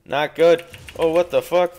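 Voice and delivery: loud, sharp frustrated outcry; frustrated outburst. Real, not acted. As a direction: blurt it out, annoyed at the screen. Losing fight in game.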